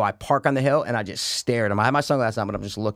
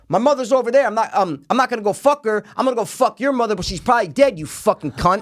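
higher imitation of a ranting friend; volley the insult back, pitched up; heated comeback; 8 semitones higher, 4 dB louder than usual. Friend firing back at golfer's insult, heated.

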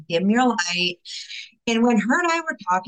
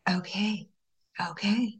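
softer, surrendering; quiet okay; 7 dB quieter, slower than usual. Retold or imitated. Yielding quietly to God's explanation, soft. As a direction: hush it out, giving in. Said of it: "And I was just like".

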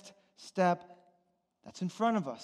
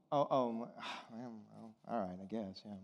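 sighing, much lower and softer; grudging sigh; 9 semitones lower, 12 dB quieter than usual. Retold or imitated. Husband caving to his wife, sighing and deflated. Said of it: "grudging reply to wife".

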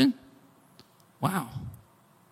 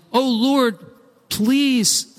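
higher, drawn-out plea to God; pleading; 8 semitones higher, much slower than usual. Acted. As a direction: beg the Lord earnestly. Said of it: "tells us he pleaded".

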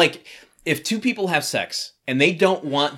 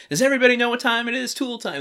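high, rapid show-opener call; imitating an excited TV warm-up shout, pitched way up; hype-up host; 11 semitones higher, faster than usual. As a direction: amp up the studio audience, imitated. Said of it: "getting the audience amped up".

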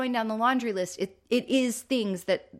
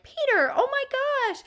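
high, loud squeal of dismay; mock dismay; 4 dB louder, faster than usual. Sister reacts as Peter's volcano sprays everyone, high mock dismay. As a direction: squeal it like an appalled big sister. Marcia yells at her brother.

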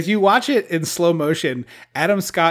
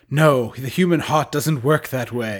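slow, put-on earnest voice; mock solemn hero. Co-host voicing Tom Cruise turning the fairy down, slow mock-earnest voice. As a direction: draw it out as a mock-noble refusal. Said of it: "And he, of course".

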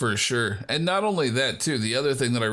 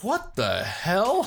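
wife's shocked reaction; 9 semitones higher, slower than usual. Retold or imitated. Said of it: "she was like".

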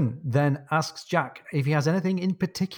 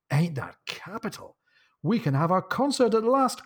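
delighted cry; 7 semitones higher than usual. A narrator's reading. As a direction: exclaim it like a thrilled captain. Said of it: "cries Jack".

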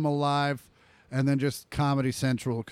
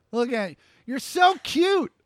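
higher, doting voice for the dog; cooing at dog; 8 semitones higher than usual. Real, not acted. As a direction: coo over the dog. Talking to the dog.

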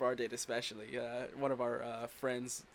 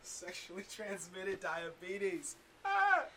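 laughing; 5 semitones higher, 3 dB quieter, much slower than usual. Real, not acted. Repeating joke while laughing.

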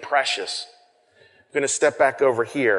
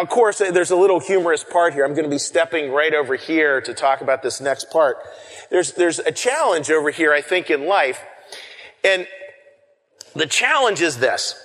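ordinary delivery

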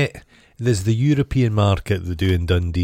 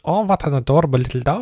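put-on German accent; 6 semitones higher, faster than usual. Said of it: "You just done a German accent".

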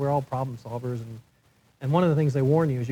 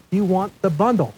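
mildly raised, emphatic retold insistence; imitating all caps; 5 semitones higher, 6 dB louder than usual. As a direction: push each word like a text in capitals. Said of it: "he texted me in all caps".

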